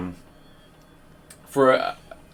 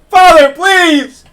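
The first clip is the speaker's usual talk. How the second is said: high, loud, drawn-out plea from below; a son's anguished cry, much higher and louder; desperate shout; 17 dB louder, much slower than usual. Acted. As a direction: scream for your father from downstairs.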